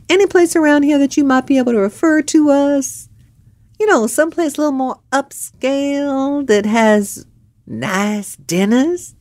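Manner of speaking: high, sugary sweet-talk; butter up the bartender; charming southern lady. Retold or imitated. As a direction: girlfriend sweet-talking the bartender for a tip.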